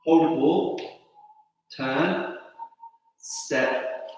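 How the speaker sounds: soft, slow calls of each move; calmly name the postures; serene tai chi cues. Acted. Soft cues during form.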